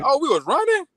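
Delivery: high-pitched retold disbelief; mock surprise. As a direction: act out being caught off guard. Retelling being caught off guard.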